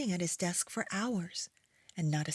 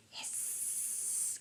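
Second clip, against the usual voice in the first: snake hiss; 10 dB quieter than usual. Shown as a demonstration. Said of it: "Can you make that sound?".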